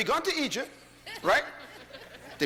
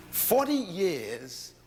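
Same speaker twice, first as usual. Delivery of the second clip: Forty years stretched long and slow, rising; slow it right down on "Forty years"; drawn-out emphasis; 2 semitones higher, much slower than usual. Acted. Preacher drags out the number.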